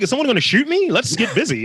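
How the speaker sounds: a big guy laughing off danger in LA, high and cocky; reckless bravado. Retold or imitated. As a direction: hype yourself up for trouble, daring anyone. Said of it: "I was like".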